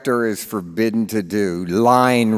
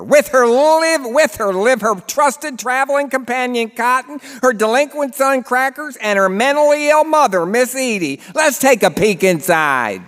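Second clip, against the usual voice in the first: high, loud Baltimore narrator, hammed-up; thick Baltimore accent; 13 semitones higher, 4 dB louder than usual. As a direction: sell the sleazy movie like a Baltimore huckster, pitched high.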